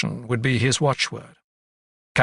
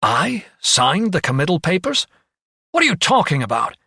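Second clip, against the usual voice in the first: higher, incredulous angry questioning; anger tinged with dismay; 6 semitones higher, 3 dB louder than usual. A narrator's reading. Doctor denies signing committal papers, angry and dismayed. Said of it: "His voice registered anger tinged with utter dismay".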